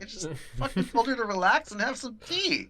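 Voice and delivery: high-pitched, incredulous insistence; amused protest. Retold or imitated. Agent answering amazed coworkers, amused protest. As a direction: protest that it was nothing special.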